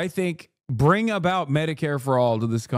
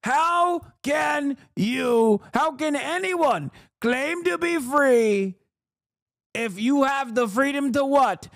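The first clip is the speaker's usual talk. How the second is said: mock rally speech; 8 semitones higher than usual. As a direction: overblown campaign oratory, dragged out and high. Impersonating Trump's rally voice.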